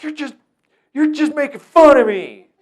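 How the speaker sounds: very high, loud indignant whine; whiny kid. Retold or imitated. Kid protesting to staff member, high whine. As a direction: complain like a wounded teenager. Kid complains being mocked.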